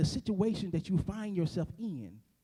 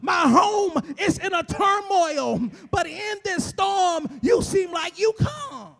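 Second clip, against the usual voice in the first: higher and louder, shouting the sermon up to a peak; preaching at full force; 8 semitones higher, 8 dB louder than usual. Acted. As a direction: build it like a Sunday whoop, climbing each phrase. Preacher builds to full whoop.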